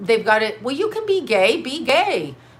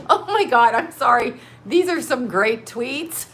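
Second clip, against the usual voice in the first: talking through laughter, higher and louder; giggling apology she can't stop; laughing; 4 semitones higher, 4 dB louder than usual. Real, not acted. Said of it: "I can't stop".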